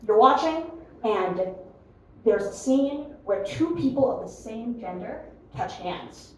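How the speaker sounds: usual speaking voice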